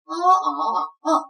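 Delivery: "ah" said with flat, rising, dipping, then falling pitch; walk "ah" through the four Mandarin tones. Shown as a demonstration. Teacher shows the class the four tones, carefully. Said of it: "different tones of one sound".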